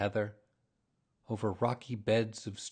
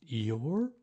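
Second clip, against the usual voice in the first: higher, measured storybook address; name him with gentle ceremony; solemn; 4 semitones higher than usual. A narrator's reading. Bear addressing his donkey friend, solemnly. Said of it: "he said solemnly".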